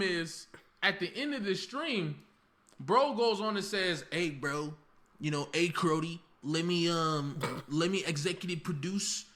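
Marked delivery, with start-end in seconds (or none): 4.1-9.3 mocking, lower, halfhearted Canadian accent; a weak stab at a Canadian accent. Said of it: "I can't even do a Canadian accent".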